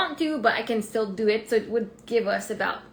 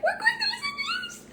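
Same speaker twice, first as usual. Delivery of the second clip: much higher, laughing squeal of joy; excited squeal. Retold or imitated. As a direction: burst out with giddy joy. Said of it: "as I was getting excited".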